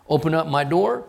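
ordinary talk

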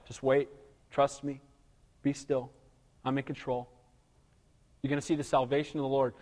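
quiet, tender confidence; calm reassurance. Retold or imitated. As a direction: soothe a frightened people. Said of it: "he says to him".

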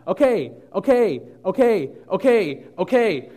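loud, high panicked okays; loud frantic okay okay; frantic yelling. Retold or imitated. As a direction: shout them out like a frantic man. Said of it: "he starts screaming".

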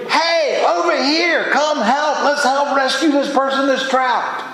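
high, urgent rescuer's call; acted scream for help. Preacher voices a rescuer who found someone trapped, high urgent yell. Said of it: "You would scream".

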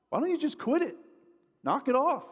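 high-pitched, exasperated telling-off; mock scolding. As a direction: imitate a counselor with no patience. Acted blunt counselor scolding a struggler.

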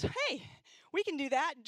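bright, high eager volunteer; mock-eager friend chiming in; eager offer. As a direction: jump in keen to help.

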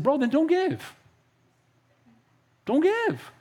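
telling a griping churchgoer to just not give, exasperated; exasperated retort. Retold or imitated. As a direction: toss it back at him, fed up. Pastor retells telling off doubter.

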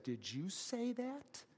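a high, slow, hissed demand; hissed question. Retold or imitated. Burglar to the parrot in the dark, a high slow hiss. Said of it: "hissed and said".

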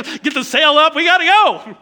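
loud, high, urgent yell; acted panicked call. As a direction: call out to the crew to flee. Voicing disciples fleeing in boat.

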